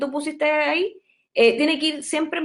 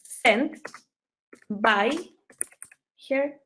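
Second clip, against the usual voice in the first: very slow, long pauses between words; slow dictation; 3 semitones lower, much slower than usual. Shown as a demonstration. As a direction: dictate word by word. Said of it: "iría". (dictado muy lento con pausas)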